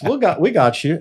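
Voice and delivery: high, loud reassuring God voice; booming comforting voice of God; voicing God. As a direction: play God soothing him, lifted and loud. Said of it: "God's like".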